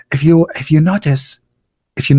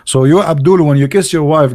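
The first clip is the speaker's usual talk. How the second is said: ordinary delivery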